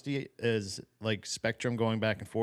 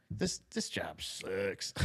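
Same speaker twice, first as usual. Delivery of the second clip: much lower, softer, slow mock gripe; low slow grumble; 5 semitones lower, 9 dB quieter, much slower than usual. Host imitating the congressman's weary complaint, slow and low. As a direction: drag it out low and quiet. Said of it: "And it's like".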